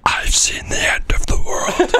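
slow, hushed doom whisper; dramatic whisper. Acted. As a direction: whisper it like a haunted survivor. Mock dramatic slow zoom scene.

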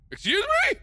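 high, loud outraged question; shocked disbelief; indignant shock. Real, not acted. Shocked by villain.

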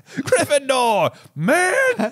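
drawn-out, very high mock proclamation; mock Sorting Hat. Sorting Hat calling out Neville's house, slow and very high. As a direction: stretch the house name out slowly, then squeak.

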